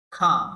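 খাম punched loud with a stressed আ; emphatic example word. Shown as a demonstration. Teacher calls out the example word loudly to the class. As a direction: hit খাম hard so the আ rings. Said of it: "একে আমরা খ বলবো".

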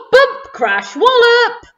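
loud exclamations leaping high on the last; dramatic crash. A narrator's reading. Narrator acting the robber's collision for children, explosively. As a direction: hurl out each impact word.

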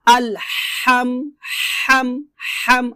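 slow model of আলহাম, tight pharyngeal h; pressed thin ha. Shown as a demonstration. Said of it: "হাটা চাপ দিয়ে চিকন করে".

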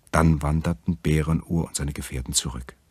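ordinary delivery, nothing marked

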